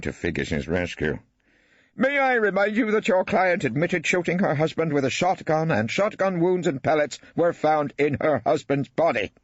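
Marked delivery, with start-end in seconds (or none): 2.0-9.3 high, reproving judge voice; a high-pitched judge correcting counsel; stern judge. Acted. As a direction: judge reminding counsel of the facts. Judge rescues witness.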